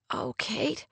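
soft, pained murmur; heart in throat. A narrator's reading. Rory responds to her upset friend, heart in her throat. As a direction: say it softly, choked with feeling. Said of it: "her heart in her throat".

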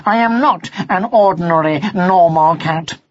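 measured, emphatic refusal; firm. A narrator's reading. Son stands up to his mother, firmly. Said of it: "said Martin firmly".

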